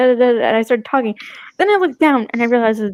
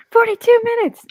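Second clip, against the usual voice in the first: imitating friend; 12 semitones higher, 3 dB louder than usual. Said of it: "Devin's little comment".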